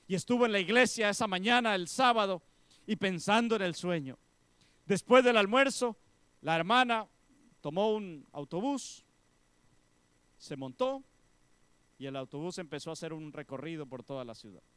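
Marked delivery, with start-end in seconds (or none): 0.1-11.0 loud, high preaching voice; loud sermon storytelling, building energy; fiery preaching. Acted. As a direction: preach it out to the congregation. Preaching at full force.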